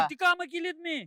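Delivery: loud, high-pitched retort; indignant protest. Acted. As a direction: argue back, raising your voice. Stung candidate argues back in quarrel. (चिडून मोठ्याने प्रत्युत्तर)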